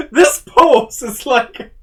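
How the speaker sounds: slow, high laughing delivery. Real, not acted. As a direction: laugh through the words. Laughing at cat pose.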